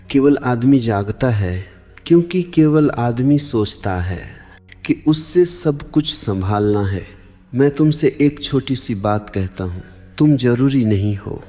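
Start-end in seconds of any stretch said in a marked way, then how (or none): none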